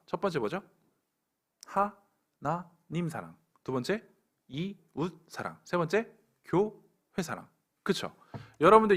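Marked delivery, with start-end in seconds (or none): 1.7-7.5 slow, deliberate listing. Real, not acted. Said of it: "slow listing of three loves".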